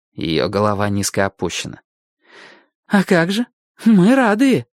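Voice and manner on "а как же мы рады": put-on girl's voice. Girl voiced in dialogue.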